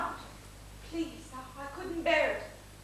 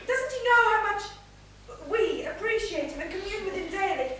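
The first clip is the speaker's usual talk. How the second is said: each question higher and louder, climbing to a gush; building excitement; 6 semitones higher, 9 dB louder than usual. Acted. Wife gushing to husband, rising excitement. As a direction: let the longing run away with you.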